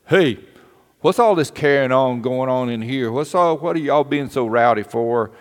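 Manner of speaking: loud, stern call into the room; scolding principal. Retold or imitated. Mister Reynolds scolding the rowdy class, loud stern call. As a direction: bark out like a principal checking a noise. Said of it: "stuck his head in the door and said".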